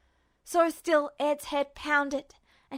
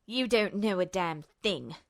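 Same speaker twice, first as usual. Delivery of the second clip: low, soft, seething villain; hissed threat; 6 semitones lower, 4 dB quieter than usual. A narrator's reading. Justin hisses at Ed, low and seething. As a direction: hiss it through rage. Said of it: "Justin hissed".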